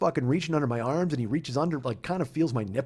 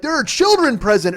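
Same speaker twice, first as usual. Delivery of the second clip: higher, louder, scandalized; prissy scolding; 8 semitones higher, 6 dB louder than usual. Retold or imitated. Agent scolding him about children, prim and scandalized. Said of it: "he's like".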